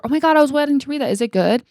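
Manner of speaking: high, loud, fast excited babble; eager fan gush. Retold or imitated. A stranger asking a reader in public about the book, fast eager squeal.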